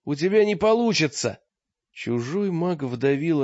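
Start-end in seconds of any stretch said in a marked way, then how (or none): 0.0-1.4 much higher, loud cry; desperate shout. A narrator's reading. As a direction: yell it out in despair. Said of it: "мысленно выкрикнул Олег".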